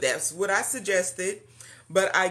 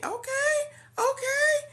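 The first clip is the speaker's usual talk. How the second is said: squeaky okay; slower than usual. Real, not acted. Reacting to a comment mid-greeting.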